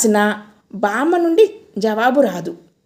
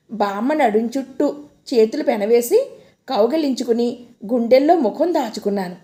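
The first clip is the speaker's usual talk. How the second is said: ordinary delivery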